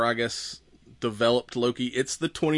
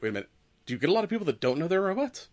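hurried, higher, fretful imitation; mock worry; 5 semitones higher, 5 dB quieter, much faster than usual. Loki anxiously questions the robot scanner, mock worry. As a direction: act out nervous second thoughts, quickly. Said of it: "the worry on it".

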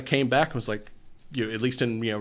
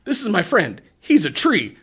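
high, swooping pitch on each phrase; retold excited hello; cheery mock greeting; 10 semitones higher, 5 dB louder than usual. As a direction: imitate an eager introduction, pitched up and punchy. Acting out a friendly introduction.

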